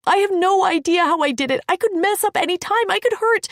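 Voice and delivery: high, loud, rising panic; panicked outburst. Acted. Panicked outburst continuing protest.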